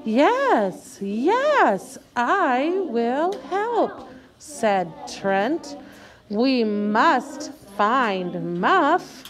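slow, word-by-word group reading; choral read-along. Shown as a demonstration. Teacher leading students in slow shared reading. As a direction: lead the class through the page.

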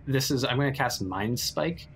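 ordinary delivery

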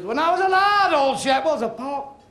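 a jokey Scots caricature; mock Scotsman. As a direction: squeak it out like a comic Scotsman. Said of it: "the Scots fella stood up".

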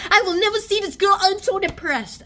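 loud, high-pitched dramatic wail; mock despair. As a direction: play up the misery, high and loud. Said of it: "he's so depressed".

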